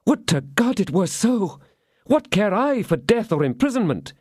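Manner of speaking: anguished outburst. Acted. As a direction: pour out the shame.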